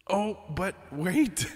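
high, loud and slow, struck by a thought; oh wait, it just hit you; sudden realization. Real, not acted. Streamer remembers there's an emote for this, surprised.

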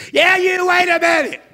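loud, high shout to stop someone; hollering. Acted. Rude passenger chases man down airport concourse, hollering. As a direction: call out urgently across a crowd.

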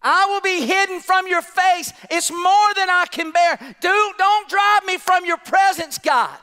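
high, anguished preacher's cry; impassioned plea. Acted. Acts Cain pleading with God.

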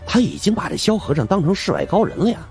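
ordinary delivery

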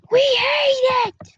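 high, slow ear-splitting shriek. Acted. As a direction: screech it out, high and drawn. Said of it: "That hurt my ears".

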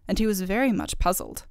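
usual speaking voice

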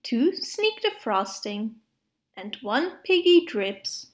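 usual speaking voice

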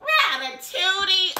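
very high, slow stunned disbelief; incredulous. Retold or imitated. Hearing about the rat, high slow disbelief. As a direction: draw it out in shock. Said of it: "I was like".

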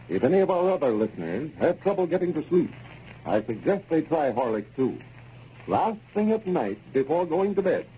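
smooth, measured commercial pitch; old-time radio ad read and goodnight sign-off; sponsor ad read. Acted.

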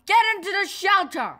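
high, fast shouted order; urgent yell. A narrator's reading. A man ordering the children to the shelter, fast and high. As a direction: hurry them into cover with a yell. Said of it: "he yelled".

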